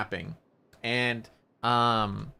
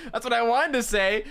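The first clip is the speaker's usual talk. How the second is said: laughing; 12 semitones higher, 3 dB louder than usual. Real, not acted. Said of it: "repeats through laughter".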